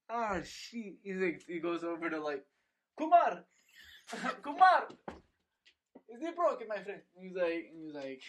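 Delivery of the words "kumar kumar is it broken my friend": high calling shout; shouting a name. Retold or imitated. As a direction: yell across the room for a friend. Voicing the Saudi guy calling.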